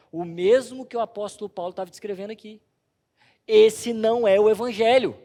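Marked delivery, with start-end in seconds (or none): 3.5-5.2 loud preaching proclamation; forceful declaration. Real, not acted. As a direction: declare it loudly from the pulpit. Pregador declara com força. (proclamação forte e alta)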